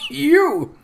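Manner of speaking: high, loud strangled cry; choking disbelief. Acted. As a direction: squeeze it out through a cough. Repeats while choking on smoke.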